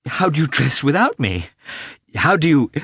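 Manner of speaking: high, forced cheer breaking off; failed attempt at cheerfulness. A narrator's reading. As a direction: try to sound cheerful through tears. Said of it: "tried to speak simply and cheerfully, but could not".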